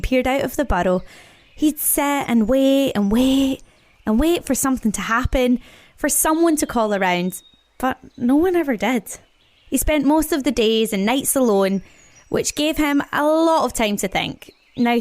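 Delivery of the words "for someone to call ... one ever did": ordinary delivery, nothing marked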